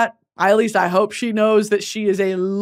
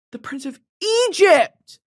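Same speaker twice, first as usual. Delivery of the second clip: excited; 8 semitones higher than usual. Real, not acted. Repeats title, quiet then burst.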